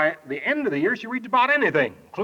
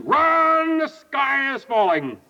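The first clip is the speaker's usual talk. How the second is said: mock yell; 6 semitones higher, much slower than usual. The whole bunch yells up to old Mister Owl, mock yell. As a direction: yell it up to someone in a tree. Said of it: "they all yelled up to him".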